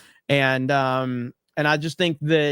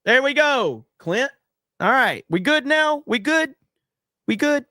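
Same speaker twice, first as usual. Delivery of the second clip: high coaxing; 9 semitones higher than usual. Real, not acted. Coaxing Clint, checking he's okay.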